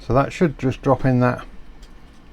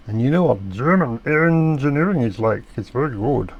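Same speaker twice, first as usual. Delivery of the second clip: jokey mock German accent; funny German accent. Praising German engineering to viewers in a mock German accent. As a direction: ham up a German accent for a laugh. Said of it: "I've adopted a funny accent there".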